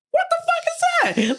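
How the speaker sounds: very high squeaky mock voice, slow; high slow mock-confused roommate.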